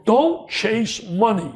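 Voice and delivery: each word laid down slowly; slow emphatic advice. Retold or imitated. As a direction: spell out the advice slowly, like a maxim. Advice he gives people.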